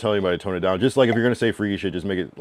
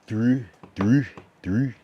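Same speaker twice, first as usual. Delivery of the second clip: Baltimore accent; 4 semitones higher, much slower than usual. Shown as a demonstration. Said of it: "Baltimore has the most unique accent".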